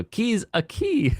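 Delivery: high, drawn-out Italian-style name; mock Italian. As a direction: stretch it like a cartoon Italian. Repeats the Italian-style name joke.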